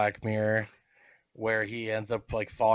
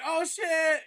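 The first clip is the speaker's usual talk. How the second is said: high, startled swear; surprised oh shit. Retold or imitated. Fan seeing the costume reveal, a startled high swear. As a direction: caught off guard, blurt it out higher. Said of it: "And you're like".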